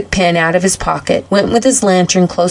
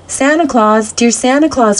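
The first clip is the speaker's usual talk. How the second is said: higher-pitched, understated pleading cry; sobbing plea; 5 semitones higher than usual. A narrator's reading. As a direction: call out pleadingly, with a mock sob. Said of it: "with a great sob".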